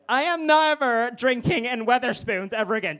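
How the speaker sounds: high, indignant mock voice; annoying teenager. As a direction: imitate a self-righteous teen making a declaration. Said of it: "this is how everyone sounds that winds me up".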